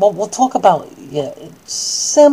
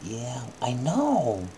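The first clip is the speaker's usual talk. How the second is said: consoling; 4 semitones lower, 7 dB quieter, much slower than usual. Acted. Consoling the child.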